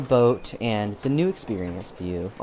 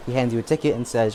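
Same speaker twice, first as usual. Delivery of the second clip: ordinary delivery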